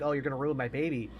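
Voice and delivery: ordinary talk, nothing marked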